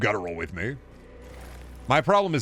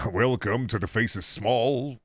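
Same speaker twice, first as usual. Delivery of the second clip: ordinary delivery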